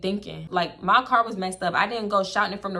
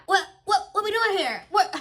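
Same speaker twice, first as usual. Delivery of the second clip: confused friend; 10 semitones higher than usual. Retold or imitated. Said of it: "when I actually pulled up".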